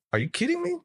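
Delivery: high-pitched, incredulous question; retold incredulity. Telling how he questioned the veteran in shock, incredulous. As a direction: let disbelief send your voice way up.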